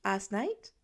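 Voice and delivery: plain reading voice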